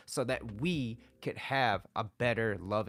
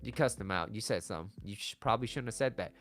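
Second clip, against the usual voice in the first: normal talking voice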